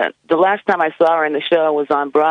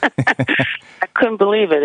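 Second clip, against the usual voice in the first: excited; 6 semitones higher, much slower than usual. Real, not acted. Recalling joy with Michael.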